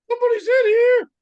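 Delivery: loud falsetto call from hiding; hammed-up squeal, drawing out the last words; comic high-pitched shout. [name] calling out from the bathroom, comic falsetto shout.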